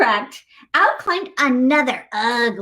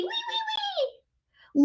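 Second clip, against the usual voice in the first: very high, slow, soft piglet squeals; tiny piggy wee wee wee; squeaky piggy squeal; 8 dB quieter, much slower than usual. Acted. Imitating the fleeing piggy.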